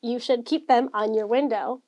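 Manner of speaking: ordinary talk, nothing marked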